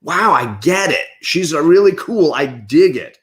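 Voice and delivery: retold delighted realization; impressed reaction. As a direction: react as if suddenly won over. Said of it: "and was like".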